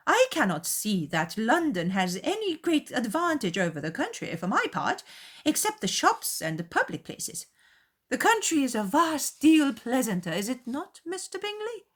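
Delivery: high, crowing matron; triumphant. A narrator's reading. Mother crowing over Darcy to Bingley, high and triumphant. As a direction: gloat over her victory. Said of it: "continued her triumph".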